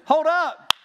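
high, loud startled whoa; hammed-up alarmed shout; mock shout. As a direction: yell it like stopping a runaway horse. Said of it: "I'm gonna go".

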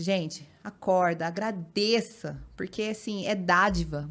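slow, deliberate urging to the followers; slow motivational plea; slow preachy urging. Shown as a demonstration. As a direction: spell it out slowly like a sermon. Preaching her online message.

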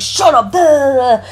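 high-pitched imitation of angry ranting; mock mad outburst. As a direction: act out his tantrum, trailing into nonsense syllables. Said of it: "He's like, gets so mad".

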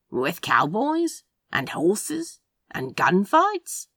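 slow, rising, dubious questions; dubious questioning. A narrator's reading. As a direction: draw out each question in disbelief. Sceptical questioning in dialogue.

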